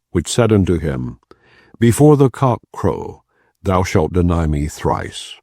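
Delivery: ordinary delivery, nothing marked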